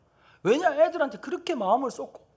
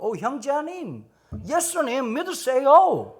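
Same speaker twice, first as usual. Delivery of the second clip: very slow, stretched-out earnest pitch; mimicked foreign missionary; 5 semitones higher, 4 dB louder, much slower than usual. Missionary evangelizing a shaman, mock slow earnest appeal. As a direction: draw out each word like a naive missionary.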